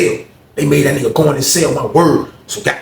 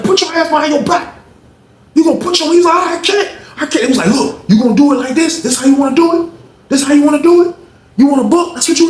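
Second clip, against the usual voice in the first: retold officer commands; 12 semitones higher than usual. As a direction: force him to comply. Officer ordering him to cuff.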